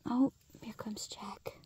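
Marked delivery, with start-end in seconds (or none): none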